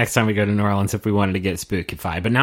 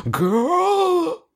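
very high, drawn-out exclamation; mock exasperation. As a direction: stretch it into a high squeal of disbelief. Mock reaction to the catchphrases.

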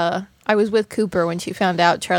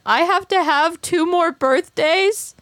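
put-on much higher, slow disbelieving child; incredulous kid; 9 semitones higher, slower than usual. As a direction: stretch it out in disbelief.

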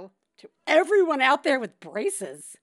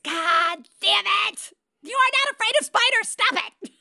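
higher, louder, fast exasperated shout; hammed-up parent yelling at scared kids; exasperated mom scolding her children about spiders; mock frustrated yell; 11 semitones higher, 5 dB louder than usual.

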